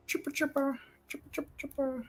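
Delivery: soft chittering critter; quiet creature chatter. Acted. GM voices creature noise.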